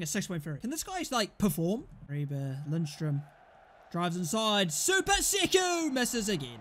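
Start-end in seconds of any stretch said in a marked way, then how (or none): 4.7-6.6 excited shout. Real, not acted. Gamer calling his striker's miss, high shout. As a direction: yell it like a caster calling a miss. Player misses, commentary shout.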